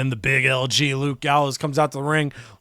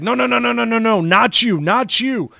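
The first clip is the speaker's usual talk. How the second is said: panicked refusal; 8 semitones higher, 3 dB louder than usual. Retold or imitated. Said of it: "Dragon Lee's like".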